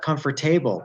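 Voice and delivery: "comfortable" as "cum-fer-TAY-bul"; wrong ABLE ending. Shown as a demonstration. Teacher showing what it's not, over-pronounced ending. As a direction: say "able" in full at the end of "comfortable". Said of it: "not pronounced able".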